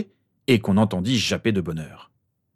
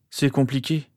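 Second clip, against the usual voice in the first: mildly sighed admission, pitched higher; sighing; 6 semitones higher than usual. A narrator's reading. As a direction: let it out with a slight sigh. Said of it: "soupira-t-il".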